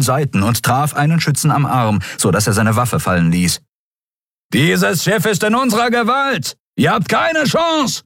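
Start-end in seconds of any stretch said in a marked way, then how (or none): 4.5-8.0 shouted threat. A narrator's reading. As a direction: bellow it down the corridor.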